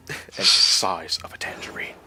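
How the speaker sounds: breathy whispered line, no voice; whispered movie quote, playful. Acted. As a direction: hush it like a secret. Whispered Austin Powers quote.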